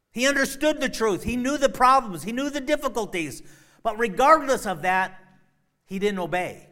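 loud, high preaching cry; preacher drives it home to the congregation; preaching at full force. Acted. Preacher proclaiming to the congregation, full force.

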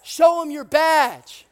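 loud, high, drawn-out yell; imitated rancher's taunting holler; yelling back. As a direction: call it slowly across a field. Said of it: "the rancher just yells back".

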